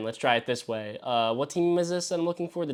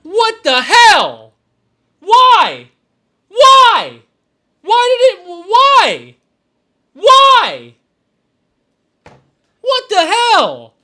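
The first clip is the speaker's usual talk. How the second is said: furious shouting; 14 dB louder, much slower than usual. Real, not acted. Raging at rejected trade.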